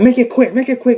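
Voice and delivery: high, loud hurrying order; impatient gunman. Acted. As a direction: hurry them along as the gunman. Gunman hurries the family.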